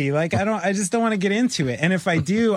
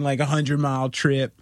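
ordinary talk, nothing marked

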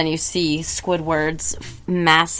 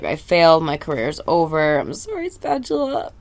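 whiny apology. Acted. Failed fry cook wailing apologies to the objects around him. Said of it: "And SpongeBob's like".

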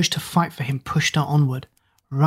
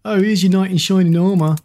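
higher, louder jeering call; mocking shout; 4 semitones higher, 4 dB louder than usual. A narrator's reading. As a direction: shout it as a taunt. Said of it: "Ben shouted".

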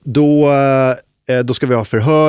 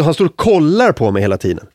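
ordinary talk, nothing marked

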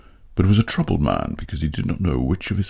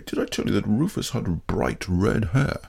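usual speaking voice

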